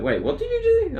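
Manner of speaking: put-on high incredulous old timer; old timer asking. Coworker quizzing me, high incredulous voice. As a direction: squeak out his disbelief. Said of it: "old timer's like".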